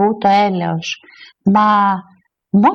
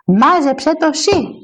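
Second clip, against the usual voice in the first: drawn-out, higher stress on σύ; indignant comeback with σύ stretched out; retort; 4 semitones higher, slower than usual. Acted. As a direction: throw it back, leaning slowly on σύ. Retort throwing the insult back.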